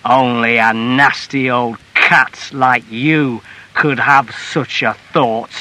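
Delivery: slow, heavy, scornful husband voice; slurring contempt at his wife; drunken husband's retort. A narrator's reading. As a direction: throw the insult back slowly.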